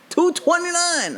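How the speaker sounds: high, drawn-out gasp at the price; shocked price read. Retold or imitated. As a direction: stretch the number out in mock horror. Reading faucet price tag.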